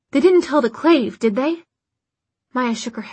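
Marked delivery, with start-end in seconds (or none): 0.1-1.6 higher, louder urgent question; demanding. A narrator's reading. Isabel presses Maya about the Clave, demanding. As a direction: press hard for the answer. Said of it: "Isabel demanded instantly".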